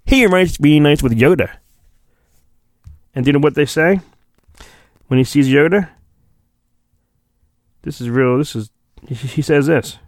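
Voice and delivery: normal talking voice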